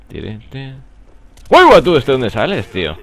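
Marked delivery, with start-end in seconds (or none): 1.5-3.0 exclamación de susto, muy aguda y fuerte; sobresalto real. Real, not acted. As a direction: chilla sorprendido por un enemigo repentino. Enemigo aparece de repente en juego. (sobresalto auténtico, a gritos)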